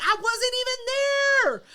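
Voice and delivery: high, loud, indignant denial; protesting innocence. Retold or imitated. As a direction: protest as if wrongly accused. Defending self when accused.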